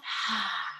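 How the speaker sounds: soft sighing exhale through the mouth; long breath out. Shown as a demonstration. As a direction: breathe out slowly with a sigh. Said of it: "breathe out through your mouth".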